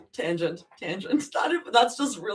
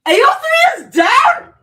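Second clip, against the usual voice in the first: much higher, loud disbelieving cry; shocked shout; 13 semitones higher, 11 dB louder than usual. Real, not acted. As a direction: yell it in real disbelief. Streamer learns the site crashed.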